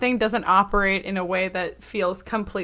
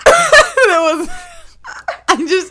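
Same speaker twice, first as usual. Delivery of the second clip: high, loud laughing apology; laughing after choking; 13 semitones higher, 6 dB louder, much slower than usual. Real, not acted. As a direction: laugh hard, barely talking. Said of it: "sounded like a spit take".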